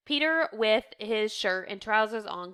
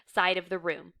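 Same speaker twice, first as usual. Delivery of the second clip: ordinary delivery, nothing marked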